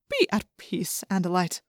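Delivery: soft, gentle reassurance; soothing. A narrator's reading. Ellimist calms the shaking Andalite, soothing. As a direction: settle a frightened listener, softly.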